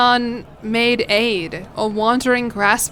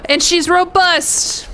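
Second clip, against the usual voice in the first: delighted gasp; 7 semitones higher, slower than usual. Real, not acted. New daughter announced.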